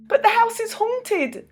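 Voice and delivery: put-on high, anxious little girl; worried girl voice. A narrator's reading. Stephanie warns Tommy, high and uneasy. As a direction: fret that the house is haunted. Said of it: "said Stephanie".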